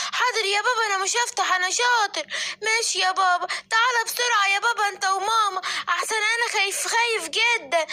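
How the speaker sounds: put-on a little boy calling for help; scared child.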